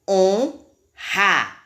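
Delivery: HONRA in separate syllables with a strong R. Shown as a demonstration. Teacher showing pupils the doubled sound, strong R in "Honra". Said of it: "Como se tivesse dois R's".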